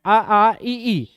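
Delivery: loud, forceful आ and ई; said loudly. Shown as a demonstration. Teacher telling students to say the vowels loudly. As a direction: belt out आ, आ, ई so the tongue move is felt. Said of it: "जोर से बोलोगे समझ आएगा".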